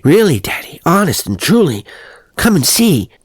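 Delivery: put-on high, loud, insistent little boy's plea; boy begging father to come see; rising crescendo. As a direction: build to a pleading peak like a child. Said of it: "The little boy's voice rose to a crescendo".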